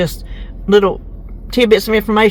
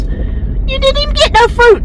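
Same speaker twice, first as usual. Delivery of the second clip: high, drawn-out incredulous squawk; mock outrage; slower than usual. Wife imitates husband seeing the skimpy fruit, mock outrage. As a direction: imitate someone astonished, pitched way up.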